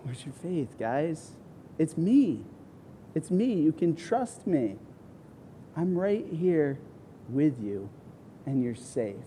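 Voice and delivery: soft, quiet reassuring tone; loving parent. Retold or imitated. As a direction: gently comfort frightened children. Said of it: "more like a loving parent".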